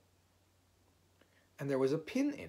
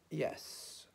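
soft, considered "yes"; wise and thoughtful; 6 semitones higher, 4 dB quieter than usual. A narrator's reading. Owl covering for himself before Rabbit, thoughtful. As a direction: answer as if pondering deeply. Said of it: "looking wise and thoughtful".

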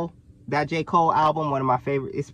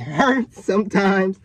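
ordinary talk

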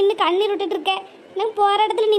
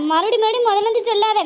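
ordinary talk, nothing marked

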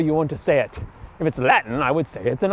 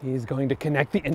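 ordinary delivery

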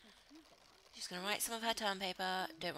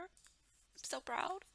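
slow, higher proud sigh; 8 semitones higher, much slower than usual. Real, not acted. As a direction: let the pride melt out slowly. Emotional drawn out.

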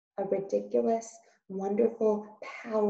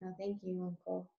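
meek; 14 dB quieter than usual. Acted. Meg answers meekly.

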